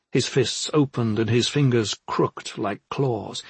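usual speaking voice

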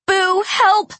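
high, loud call for help; shouting. Acted. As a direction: yell out for help. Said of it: "shouted Aru".

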